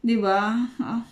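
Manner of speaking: ordinary talk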